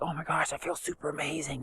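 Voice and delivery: hushed, airy mock amazement; softly mimicking someone blissed-out; mock gushing. Mock gushing, hypothetical quote.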